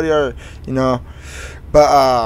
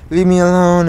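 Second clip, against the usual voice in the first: louder, higher mock snap; retold upset protest; 6 semitones higher, 6 dB louder than usual. Upset kid to the friend comforting him, mildly snappy.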